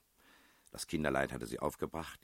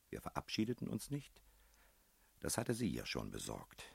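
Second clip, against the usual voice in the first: ordinary delivery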